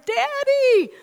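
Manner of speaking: very high, loud, drawn-out mock wail; child crying out. Daughter cries out at another thunderclap, high imitated wail. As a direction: wail for Daddy like a little girl. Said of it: "Monica to cry out again".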